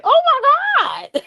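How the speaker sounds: very high-pitched swooning "oh my God"; giddy squeal. Retold or imitated. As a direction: squeal it, about to burst out laughing. Retelling her giddy reaction to the voice.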